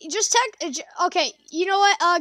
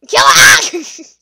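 high loud yell of urgency; shout; 9 semitones higher, 13 dB louder than usual. Real, not acted. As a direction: scream the order. Urging to kill enemy.

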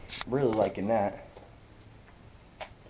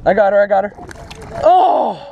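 loud, high, thrilled shouting; real excited yell; excited triumph; 9 semitones higher, 11 dB louder than usual. Real, not acted. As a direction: call out in triumph as the fish is landed. Landing the fish.

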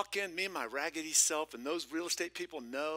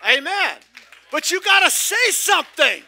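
much higher and much louder preaching cry; full-force preaching; 22 dB louder than usual. Real, not acted. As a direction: preach it at the top of your lungs.

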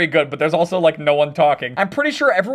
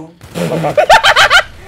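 high, loud burst of laughter; 8 semitones higher, 9 dB louder than usual. Real, not acted. Gamer cracking up in the middle of a match, laughing loudly. Laughing wildly while losing at game.

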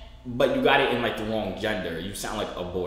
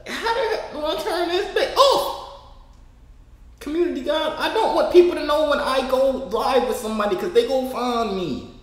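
high-pitched exasperated whine; 11 semitones higher, 4 dB louder than usual. Real, not acted. Streamer hunting settings to hide from viewers, high exasperated voice. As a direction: complain in a much higher voice.

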